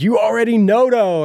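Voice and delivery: put-on high, loud kids chanting [name]; [name] impression. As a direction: belt the [name] line like kids. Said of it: "the way [name] says is like".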